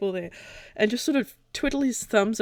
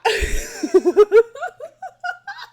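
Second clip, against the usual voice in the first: high delighted laugh; 8 dB louder than usual. Real, not acted. Delighted at recognizing a voice.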